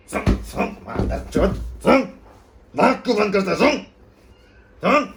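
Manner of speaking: loud, high, explosive repeated orders; furious shouted command. Acted. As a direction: yell each order at him in rage. Forcing him to smell the corpses. (गुस्से में चीख-चीखकर हुक्म देना)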